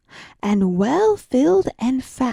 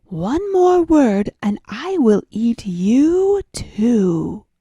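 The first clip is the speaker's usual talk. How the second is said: slow, threatening whisper; menacing whisper; 3 dB louder than usual. Acted. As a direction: hiss the threat slowly. Cat threatens mouse.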